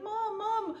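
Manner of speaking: high, tearful little cry for mom; crying baby dinosaur. Retold or imitated. Baby dinosaur wakes crying for his dead mom. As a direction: call out like an orphaned baby crying. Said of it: "and he's crying".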